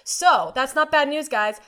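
usual speaking voice

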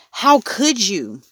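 high, drawn-out reproach; reproachful outcry. A narrator's reading. Son confronting Mom over his turtle, reproachful.

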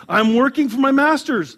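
proud boast. Acted. Mother's proud reply.

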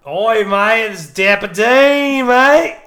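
loud, high Aussie voice; loud Australian accent. Pretend.

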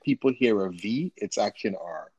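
normal talking voice

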